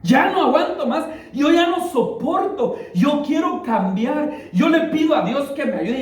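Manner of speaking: high, slow pleading, voice strained and breaking; retold breakdown cry. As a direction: replay a hard woman finally breaking down. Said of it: "empieza a gritar". (clamor quebrantado, agudo y lento)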